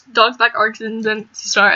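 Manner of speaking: ordinary talk, nothing marked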